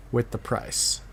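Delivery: normal talking voice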